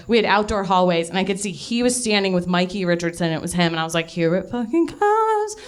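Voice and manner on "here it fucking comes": high, drawn-out retold excitement; imitating breathless teenage anticipation; giddy anticipation. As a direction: stretch it out, pitched high with mounting excitement.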